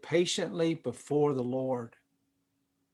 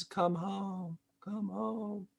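wife calling softly; 3 semitones higher, 11 dB quieter, much slower than usual. Retold or imitated. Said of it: "She says".

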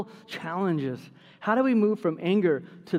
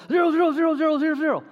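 rapid, raised-pitch run of zeros; frantic repetition; 10 semitones higher, 5 dB louder, faster than usual. Acted. Caller jabbing zero to reach a real person, frantic. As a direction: rattle off the zeros in one impatient burst. Mimes hammering zero at a phone menu.

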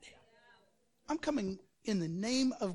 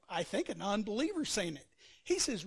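ordinary talk, nothing marked

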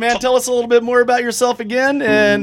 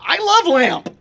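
higher, dim-witted Brick voice; Brick impression; 7 semitones higher than usual. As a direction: say it like a dopey Brick. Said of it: "we can do the whole bit".

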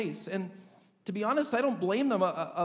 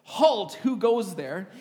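firm soldier's challenge, louder; stern sentry challenge, raised but controlled; strong commanding voice; 7 dB louder, slower than usual. Retold or imitated. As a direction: call the halt like a guard. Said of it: "in a strong voice like".

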